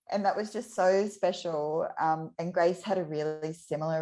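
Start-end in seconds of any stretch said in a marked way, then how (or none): none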